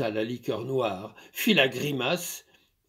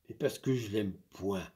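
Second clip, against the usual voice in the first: softer, lower meek reply; timid refusal; 5 semitones lower, 6 dB quieter than usual. Acted. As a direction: shrink from refusing. Maid shy answer.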